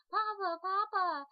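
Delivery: an eager little son shouting for his dad; excited small child's cry; little boy calling. Pretend. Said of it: "bring out and be like".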